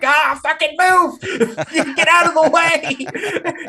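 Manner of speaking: high, slow, frantic yell at his daughter; yelling in alarm for her to move; panicked yell. Retold or imitated. Shouting at daughter beside a flaming plate, high and frantic. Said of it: "And I'm like".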